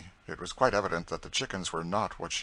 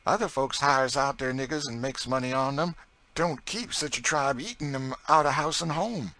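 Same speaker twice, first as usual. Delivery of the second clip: higher, louder, folksy dialect voice; chatty Southern cook; 7 semitones higher, 5 dB louder than usual. A narrator's reading. Narrator voices Chloe in dialect.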